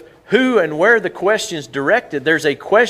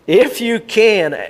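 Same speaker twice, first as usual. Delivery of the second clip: "If you can" drawn out, higher and slow; stress on IF; 7 semitones higher, 4 dB louder, much slower than usual. Shown as a demonstration. Preacher stressing Jesus' words to the church, slow and high. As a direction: lean hard on "If you can" and stretch it. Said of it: "said Jesus".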